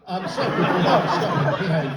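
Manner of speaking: usual speaking voice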